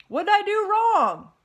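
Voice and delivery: loud, high, slow bewildered pig; confused pig. Acted. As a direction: plead to know what went wrong. Wilbur confused.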